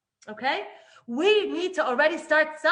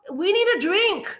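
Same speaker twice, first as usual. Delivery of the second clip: loud, higher, calling out to the leader; demanding crowd; 5 semitones higher, 8 dB louder than usual. Retold or imitated. Thirsty people shouting at Moshe for water, loud and desperate. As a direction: yell for Moshe's attention. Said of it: "And then they're like".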